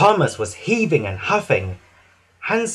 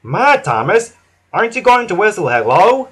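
higher, louder friendly nudge; cheerful Hank; 6 semitones higher, 5 dB louder than usual. A narrator's reading. Hank urging Thomas to whistle, cheerful and loud. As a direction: coax a tired friend kindly. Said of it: "Hank whooshed happily".

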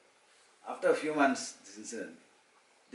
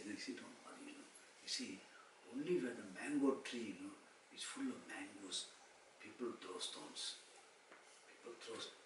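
soothing counsel; 14 dB quieter than usual. Retold or imitated. Guru consoling devotee with a parable, hushed and gentle. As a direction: explain it very softly, to console.

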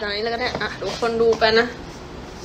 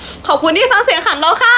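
higher, louder, sugary idol thank-you with a rise on ค่ะ; sweet polite thanks; 9 semitones higher, 5 dB louder than usual. Shown as a demonstration. Idol demonstrating to fans a sweet higher thank-you. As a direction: thank them brightly like a cute idol. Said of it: "ก็ต้องพูดว่า".

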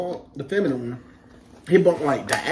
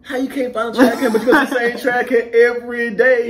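loud, higher, incredulous challenge; laughing disbelief; 7 semitones higher, 7 dB louder than usual. Retold or imitated. Recruit scoffs at the trash crew's excuse, laughing disbelief. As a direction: call out the excuse through laughter.

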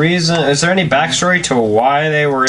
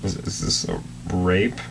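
much softer and lower, a stunned aside; hushed dread; 8 semitones lower, 10 dB quieter than usual. Real, not acted. Friend asking the reader in a hushed, appalled voice. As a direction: ask it under your breath, appalled. Horrified quiet question.

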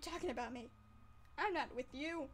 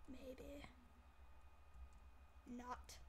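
teasing whisper; 16 dB quieter than usual. Acted. Teasing viewer, sudden whisper.

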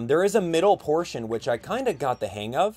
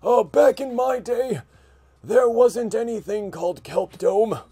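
put-on high, slow creaky old-timer; old man voice; 10 semitones higher, 5 dB louder, slower than usual. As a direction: reminisce like a grumpy grandpa. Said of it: "sounding like an old man".

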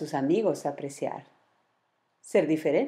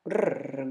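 growl. Acted. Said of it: "gruñó Omar".

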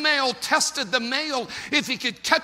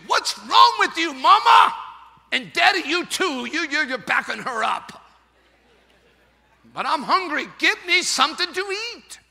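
angry eaglet complaint; 5 semitones higher, 5 dB louder than usual. Acted. Eaglet scolds parents.

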